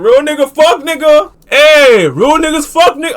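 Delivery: loud, high rallying cries; hype shouting. Real, not acted. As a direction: yell it like a crowd slogan. Chanting slogan at chat.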